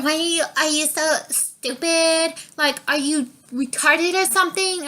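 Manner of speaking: rude customer. Retold or imitated. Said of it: "you know".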